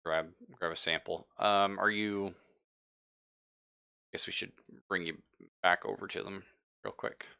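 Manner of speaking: normal talking voice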